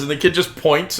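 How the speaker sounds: normal talking voice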